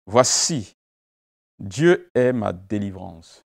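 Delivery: ordinary delivery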